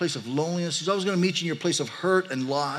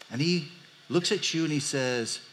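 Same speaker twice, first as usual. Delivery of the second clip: ordinary delivery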